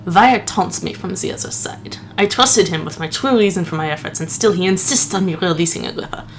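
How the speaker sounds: a hint of the lisping Princess Bride priest; mock-solemn clergyman read of the note. Gamer reads journal, slipping into the Princess Bride priest. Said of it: "sounding like the priest from The Princess Bride".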